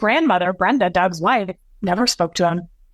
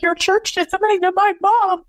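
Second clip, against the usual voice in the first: imitating a desperate sobbing plea; 11 semitones higher than usual. Son defending mom to grandfather, high tearful outburst. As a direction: cry it out, pitched way up. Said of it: "it was so heart-wrenching".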